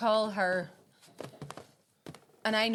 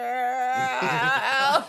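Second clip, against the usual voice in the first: laughing; 5 semitones higher, 10 dB louder than usual. Retold or imitated. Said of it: "watches that every Christmas and goes".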